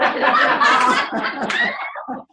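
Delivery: shrill burst of laughter, very high; high squealing laugh. Real, not acted. Laughing at the monkey hiding its crime.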